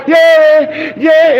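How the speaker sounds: loud mock cheer from the crowd. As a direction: call out like a hyped crowd. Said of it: "the crowd's like".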